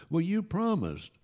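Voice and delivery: a hint of an let-down child; lightly voiced child. Kids protest a broken promise.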